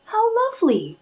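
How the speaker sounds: high, wistful little mouse; tiny mouse voice. Acted. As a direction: say it small and high. Mouseling answers toad.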